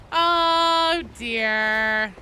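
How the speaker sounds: loud, long-held "oh dear"; big, sustained sigh of a phrase; amused dismay. Real, not acted. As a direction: draw it out in theatrical dismay. Reacting to absurd game bug.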